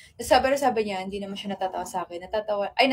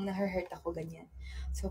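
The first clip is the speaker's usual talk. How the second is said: ordinary delivery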